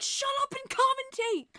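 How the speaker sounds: high, loud snap; annoyed yell. Retold or imitated. Fan yelling at chatty commentators, loud. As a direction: tell them off sharply. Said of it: "And I'm like".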